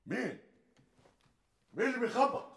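high, loud alarmed call at the door; startled shout. Acted. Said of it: "صوتك عالي كده ليه؟".

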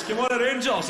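high, loud football fan's yell; shouting. Retold or imitated. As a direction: bellow support as a passing fan. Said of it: "He shouts".

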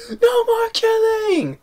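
very high, slow mock scream; imitated scream. Quoting the pool fight, a high slow mock scream. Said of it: "the way he screams".

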